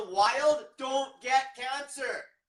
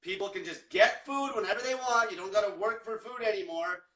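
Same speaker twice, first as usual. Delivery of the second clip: ordinary delivery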